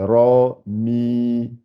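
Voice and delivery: "ra, mi" with each vowel held long; lengthened vowels. Shown as a demonstration. Teacher showing the lengthening letter, "ra, mi" with long vowels. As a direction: draw out the vowels of "ra, mi". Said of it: "c'est pour allonger le i".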